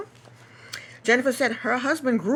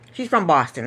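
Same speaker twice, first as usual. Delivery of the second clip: ordinary delivery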